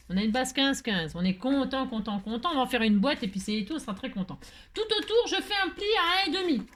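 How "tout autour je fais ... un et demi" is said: high-pitched, insistent call; crying out instructions. Real, not acted. Crafter telling viewers where to fold, crying out high. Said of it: "je me fatigue moi-même, hein, à crier".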